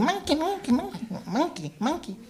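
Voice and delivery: scared servant pointing out the monkey; high, panicked Indian servant crying monkey; frantic servant. Retold or imitated. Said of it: "He was going".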